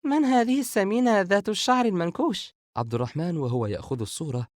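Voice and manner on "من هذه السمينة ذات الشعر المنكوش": put-on very high, teasing girl's voice; amused. A narrator's reading. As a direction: tease in a high-pitched, merry voice. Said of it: "سارة ضاحكة".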